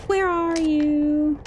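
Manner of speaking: slow, drawn-out teasing call; slow singsong where are you; sing-song call. Pretend. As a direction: coax them out like hide-and-seek.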